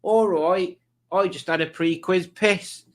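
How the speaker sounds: high, broad put-on voice; Alex impression. Streamer reading out a viewer's comment, in a high impersonating voice. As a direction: announce it in a high, exaggerated accent. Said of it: "a good impression of Alex".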